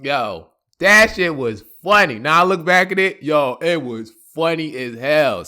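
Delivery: ordinary delivery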